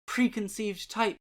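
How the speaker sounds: measured, expressive recital of poems and prose; dramatic poetry reading. Acted. Channel host reading poems aloud for viewers, dramatic reading. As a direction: read each verse with feeling, letting lines breathe.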